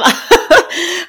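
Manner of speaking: high bursting giggle. Real, not acted. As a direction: break into a real giggle. Laughing at her blocked nose.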